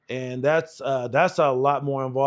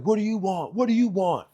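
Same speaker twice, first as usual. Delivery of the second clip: exasperated retort; 5 semitones higher than usual. Retold or imitated. Annoyed instructors answer the recruit.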